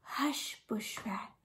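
whisper. A narrator's reading. Rabbit hushes Bush Rat in the tree, whispering. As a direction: hush him quietly so the lion won't hear. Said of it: "Rabbit whispered".

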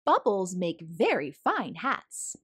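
plain reading voice

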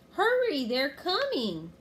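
high shout easing into a drawn-out cry; loud, pressing alarm call; urgent warning. A narrator's reading. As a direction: call out in alarm, stretching the end. Annie warning of pirates.

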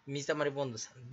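usual speaking voice

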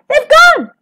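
high, loud wail of dismay; panicked, high-pitched exclamation; alarmed cry. A narrator's reading. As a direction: cry out the terrible discovery. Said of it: "she cried".

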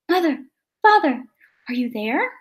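ordinary delivery, nothing marked

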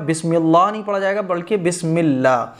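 usual speaking voice

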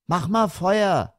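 put-on high, slow child's demand; demanding son. A narrator's reading. Son demanding a fire from father, insistent. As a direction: stretch it out like an insistent boy. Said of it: "verlangte der Sohn".

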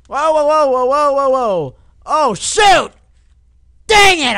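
high, loud burst of alarm; alarmed shouting. Real, not acted. Player watching himself crash, alarmed shouting. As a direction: yell as it all goes wrong.

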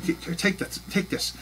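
urgent whisper, take this; whispered urging. Pretend. Pretending to hand the phone to the gate agent, whispered. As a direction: hush and push the phone over. Acting handing the phone over.

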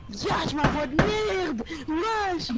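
loud, high-pitched yell; playful yelling. Pretend. Streamer clowning on a voice chat, high yell. Yelling while hearing the voice back.

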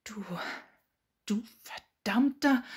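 seething, broken-off insult; incensed. A narrator's reading. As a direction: spit out the insult slowly, piece by piece. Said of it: "sah ihn aufgebracht an".

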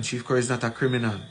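normal talking voice

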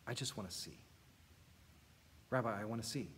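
hushed, tender plea; soft plea. Retold or imitated. Blind man quietly asking Jesus to see. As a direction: ask it gently, almost under your breath. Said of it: "The blind man said".

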